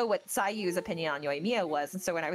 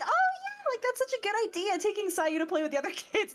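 much higher, fast and chirpy; Yoimiya headspace; 13 semitones higher than usual. Retold or imitated. She cheerfully imagines taking Sayu out, much higher. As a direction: squeal with oblivious delight. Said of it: "And I'm like".

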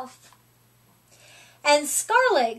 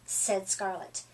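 ordinary delivery

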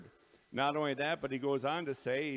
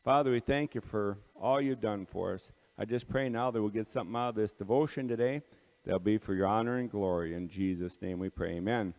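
prayer; 4 semitones lower than usual. Real, not acted. Closing prayer before devotion.

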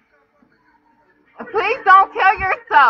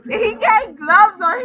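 laughing; 3 dB quieter than usual. Real, not acted.

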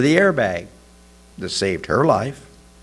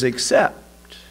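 ordinary delivery

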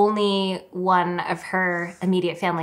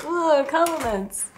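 mock swoon; 6 semitones higher, slower than usual. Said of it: "you've been like".

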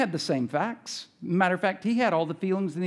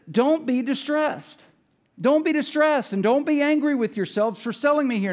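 reassuring Joseph; 7 semitones higher than usual. Acted. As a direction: plead with them not to blame themselves. Joseph comforting his brothers.

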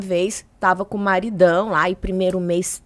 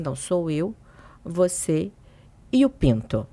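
lower, softer deadpan; a grave husband delivering the punchline; serious husband; 4 semitones lower, 5 dB quieter than usual. Retold or imitated. Comedian voicing her husband's deadpan reveal, low and soft. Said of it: "Ele bem sério".

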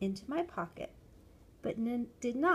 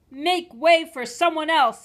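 high, very loud dismissal; angry shout; 8 semitones higher, 14 dB louder than usual. A narrator's reading. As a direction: shout the customer out of the way. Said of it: "he shouted at me".

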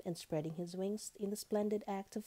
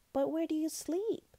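high-pitched little lamb question; lamb's high questioning voice; curious lamb; 11 semitones higher, 4 dB louder than usual. A narrator's reading. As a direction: ask high and eager, as the lamb. Lamb questions butterfly in a story.

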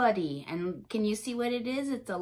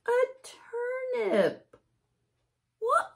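slow, much higher cry; surprised; 13 semitones higher, much slower than usual. Acted. Reader discovering a turnip head, shocked. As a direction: disbelief stretched out.

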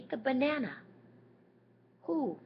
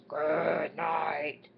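lion voice; 4 dB louder than usual. Acted. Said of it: "The lion says".

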